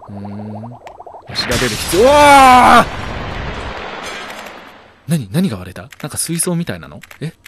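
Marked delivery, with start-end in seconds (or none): 2.0-5.1 real loud high scream of fright; long piercing yell of shock; startled scream. Real, not acted. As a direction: jump out of your skin. Something shatters nearby.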